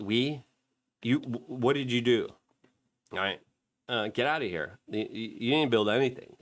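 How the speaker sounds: ordinary talk, nothing marked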